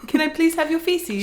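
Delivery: high, loud, put-on polite request; mock-polite investigator. Imitating investigator asking cook for samples, absurdly polite. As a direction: ask in an exaggerated high voice.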